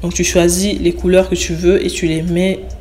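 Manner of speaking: ordinary talk, nothing marked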